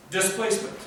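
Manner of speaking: usual speaking voice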